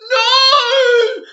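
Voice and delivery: loud, high anguished wail of "no"; devastated scream. Retold or imitated. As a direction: scream it like your trip just collapsed. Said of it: "the girl was like".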